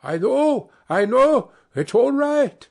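very high, loud, cheerful yell. A narrator's reading. Harlequin calling back from the shore, cheerful yell. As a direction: shout back breezily, all is well. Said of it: "yelled back the other, as cheerful as you please".

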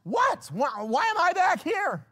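high-pitched, comic bewilderment; indignant disbelief. Acted. Lazarus unwrapped and back from heaven, indignant disbelief. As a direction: squawk it high, in mock dismay. Lazarus dismayed at being raised.

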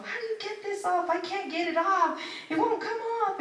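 father failing to scrub off nail polish; mock frustration. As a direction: whine about the stuck polish. Dad struggling with nail polish.

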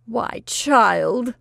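loud dismayed cry. Acted. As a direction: cry out to the child. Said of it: "cried Missus Harvey".

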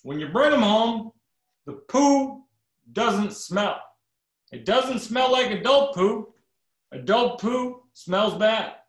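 high, hammed-up president, slowing on the poo; mock Obama, earnest about poo; playful impression of the president. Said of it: "then Obama says".

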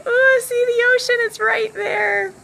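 high, loud delight at the view; real thrill, pointing out the sea; showing off the ocean to viewers, excitedly. Real, not acted.

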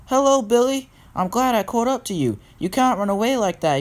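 high-pitched storybook engine; greet, then gently scold, pitched high; Thomas, friendly scolding engine. A narrator's reading. Thomas catches up and scolds Billy.

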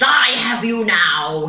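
slow, loud tiger growl; drawn-out menacing beast voice. A narrator's reading. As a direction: growl it slowly as you lunge. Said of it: "he growled".

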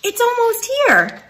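high bird voice, falling tune. Acted. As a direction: settle it down like a bird. Second bird answers.

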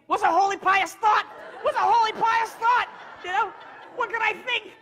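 high, loud frantic wailing; mock panic. Acting out own panicked search for a pious thought, mock-frantic. Frantic inner voice, retold in story.

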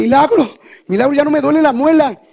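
high loud cry of relief; joyful exclaim. Retold or imitated. Wife crying out that her tooth is healed. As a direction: shout it like a miracle. Wife overjoyed, pain gone.

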